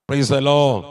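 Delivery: low, loud cry of praise; loud praise call. Real, not acted. Preacher shouting praise to the congregation, loud and low. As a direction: call it out to the hall.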